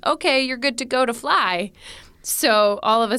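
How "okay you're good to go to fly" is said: a lightly voiced automated announcement, a bit higher; computer voice. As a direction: mimic the rig's computer telling her she's cleared. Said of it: "the computer says".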